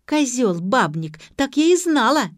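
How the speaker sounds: loud furious accusations; shouting rising to a high scream; jealous yelling. A narrator's reading. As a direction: hurl the insults at him.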